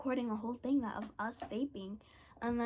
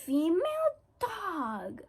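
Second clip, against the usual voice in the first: much higher, louder and slow, near crying; dramatic mock insult; 7 semitones higher, 5 dB louder, much slower than usual. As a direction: wail the insult for comedy. Insulting the friend, acted quote.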